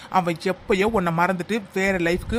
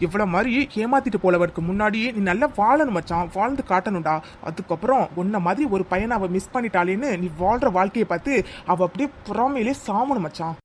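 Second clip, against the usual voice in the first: ordinary talk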